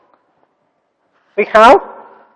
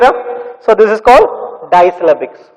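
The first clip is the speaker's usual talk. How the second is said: ordinary talk, nothing marked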